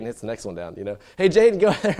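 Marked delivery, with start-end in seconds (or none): 1.2-2.0 calling out. Retold or imitated. Son calls brother.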